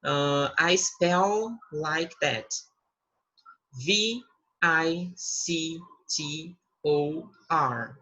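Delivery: slow English self-introduction, letters drawn out; spelling name slowly. Shown as a demonstration. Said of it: "eu vou pronunciar meu nome, por exemplo". (soletrando o nome bem devagar)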